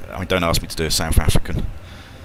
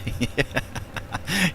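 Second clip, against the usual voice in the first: high, soft giggle; laughing; 10 semitones higher, 5 dB quieter than usual. Real, not acted. Amused by a chat message.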